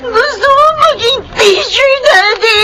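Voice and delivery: sumisigaw habang umiiyak; umiiyak na sigaw. Acted. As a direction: isigaw ang pangarap nang may luha. Daughter shouts her wish. (umiiyak na sigaw sa daddy)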